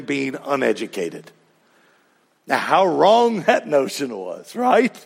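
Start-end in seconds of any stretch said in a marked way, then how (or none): none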